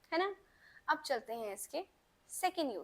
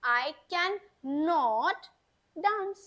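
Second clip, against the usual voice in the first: model sentence; 5 dB louder, much slower than usual. Shown as a demonstration. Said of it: "तो हम कैसे बनाएंगे?".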